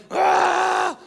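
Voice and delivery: high, drawn-out yell over someone; loud mock scream. Refusing to hear his brother, screaming comically. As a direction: cut them off with a loud high "aah". Drowns out the other arguer.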